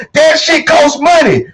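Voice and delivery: drawn-out shout, preacher style; emphatic shout. Real, not acted. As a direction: hammer home the point about cost. Ranting about daily costs.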